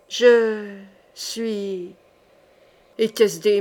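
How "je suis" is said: dead man's voice. Acted. The corpse answers the sorcerer, faint and deathly. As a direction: let it barely escape the lips. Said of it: "C'étaient des sons morts".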